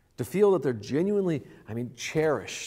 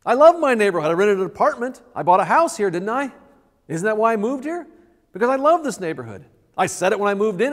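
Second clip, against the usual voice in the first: higher, louder smug claim; self-satisfied boast; 6 semitones higher, 7 dB louder than usual. Pretend. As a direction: brag like it settles everything. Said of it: "responding with".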